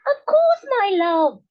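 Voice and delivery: the wife answers her husband mid-mouthful. A narrator's reading. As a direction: answer sweetly while eating. Wife answering while eating.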